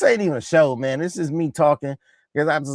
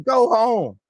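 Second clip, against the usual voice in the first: higher dismissive call; mock taunt; 6 semitones higher, 3 dB louder than usual. Quoting the player sending a rival off, higher mock taunt. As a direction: imitate a player waving someone away, pitch up.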